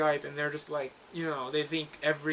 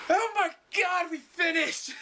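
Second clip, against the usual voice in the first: high, loud cheer of relief; excited shout; 13 semitones higher, 4 dB louder, slower than usual. Real, not acted. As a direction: shout it out in triumph. Celebrating finishing the reading.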